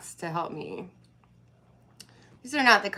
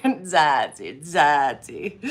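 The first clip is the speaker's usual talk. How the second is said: laughing; 2 semitones lower, 6 dB louder, much slower than usual. Real, not acted. Laughing at her own joke.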